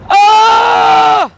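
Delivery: long, high, loud "ah" yell; imitation of a pushed second-try yell. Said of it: "Look, go louder, push it".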